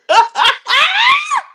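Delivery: very high cackle; real shriek of laughter. Real, not acted. Laughs at the mispronunciation.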